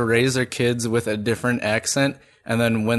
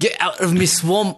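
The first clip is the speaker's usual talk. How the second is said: higher, grumpy Scottish bark; a cranky Scot chasing folk off his land; mock-furious Scottish ogre; angry Scottish accent; 7 semitones higher than usual. Shown as a demonstration. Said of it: "you can just sound kinda pissed off".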